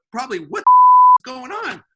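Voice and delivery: loud, high startled yell from the boss; annoyed shout through the office window. Retold or imitated. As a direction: bellow it like an interrupted boss. Boss reacts to man at window.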